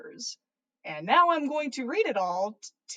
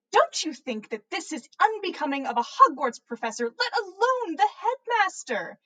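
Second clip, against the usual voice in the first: slightly peeved; 7 semitones higher, 4 dB louder than usual. A narrator's reading. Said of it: "slightly peeved".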